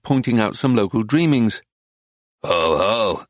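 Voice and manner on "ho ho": low wheezing chuckle, lightly voiced; wheezy cackle. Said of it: "he broke into a wheezy cackle".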